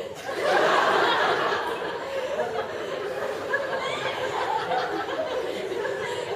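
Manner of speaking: high breathless unvoiced laughing; cracking up at the memory; helpless laughter. Real, not acted. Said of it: "I've got your mum's lipstick on".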